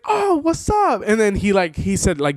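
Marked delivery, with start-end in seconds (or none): 0.0-1.0 very high-pitched, delighted hello; imitated thrilled greeting; excited greeting. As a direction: greet a friend you just recognized, voice shooting up. Acts out Greg's excited greeting.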